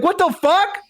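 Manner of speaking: shocked parent. Retold or imitated. Parent stunned by a talking baby, shocked outburst. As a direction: recoil in disbelief. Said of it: "You'd be like".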